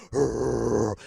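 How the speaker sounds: low, loud rolled growl; imitated dog growl. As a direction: growl like a big dog putting on a show. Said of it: "he's like".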